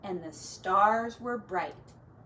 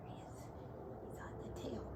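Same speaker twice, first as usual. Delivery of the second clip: whispered pointing at the snail; 16 dB quieter, much faster than usual. Real, not acted. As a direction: hush and point at the page. Pointing at picture for child.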